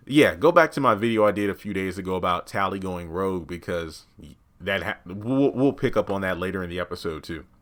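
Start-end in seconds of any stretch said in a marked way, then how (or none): none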